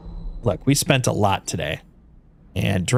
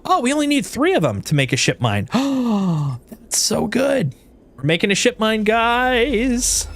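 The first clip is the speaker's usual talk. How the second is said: high, gasping delight; thrilled to have built it; 9 semitones higher than usual. Real, not acted. Crafting succeeds, delighted.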